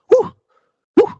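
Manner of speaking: high-pitched, comic wrestler's woo; Will Ferrell's woo. Retold or imitated. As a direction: parody a wrestler's catchphrase whoop in short, high bursts. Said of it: "when he says the woo part".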